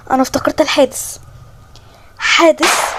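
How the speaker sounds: normal talking voice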